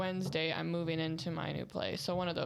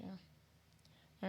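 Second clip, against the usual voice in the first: whisper; 15 dB quieter than usual. Pretend.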